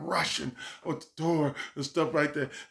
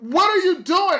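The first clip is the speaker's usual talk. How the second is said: much higher, louder demanding mom; annoyed mom; 10 dB louder than usual. Retold or imitated. A mom demanding where her son is, much higher and louder. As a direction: hit it as an exasperated mom.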